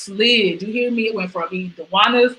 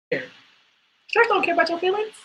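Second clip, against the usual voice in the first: high, sing-song mock quip; sassy jab; 12 semitones higher than usual. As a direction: toss it off with sass. Sarcastic sung-out remark.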